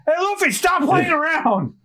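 high, sharp mock yell; high-pitched acted telling-off; exasperated scolding. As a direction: call out to rein in a goofball friend. Companion scolds across the scene.

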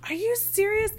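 high-pitched mock disbelief; incredulous. Friends realizing their tickets had perks, high incredulous cry. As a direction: shoot it up in shock.

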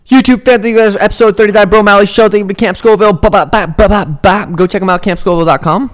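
loud, high hype outro read; show outro. Acted. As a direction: pump up the sign-off like a host.

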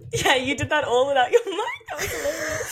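high, laughing through the words; can't stop laughing at her friend; giggling at the mic mix-up. Real, not acted.

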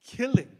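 killing hit high and loud; stress on killing. Real, not acted. Preacher warning congregation, killing punched high and loud. Warning about deadly false teaching.

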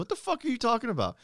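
high-pitched disbelieving challenge; incredulous retort. Retold or imitated. Son challenging his mom at breakfast, incredulous.

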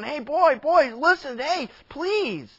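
high, urgent acted plea; pleading father. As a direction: beg your son to listen. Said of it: "came out and pleaded with him".

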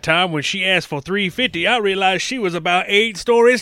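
loud, high and excited; loud, high reenactment of a cartoon; hammed-up retelling. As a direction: belt out the cartoon plot like a rant. Loudly re-enacts the cartoon clip.

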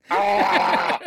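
loud high laughing yell; laughing shout. Real, not acted. Laughing outburst at joke.

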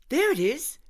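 high, bright spotting call; excited pointing. Acted. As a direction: point it out eagerly. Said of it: "said Gina, pointing".

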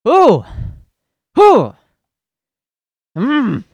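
loud high ooh hoots; whooping with hype; excited hoots. Real, not acted. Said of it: "jazzed up".

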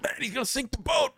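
high panicked shout about the boat. Retold or imitated. Friend panicking as shark thrashes.

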